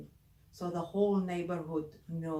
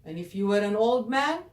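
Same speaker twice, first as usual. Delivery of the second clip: beast calling out; 7 dB louder than usual. Acted. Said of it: "started thinking".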